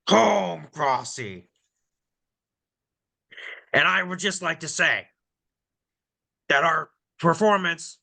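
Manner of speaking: ranting character voice, rising to outrage; Vikings voice. Pretend. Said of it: "in my Vikings voice".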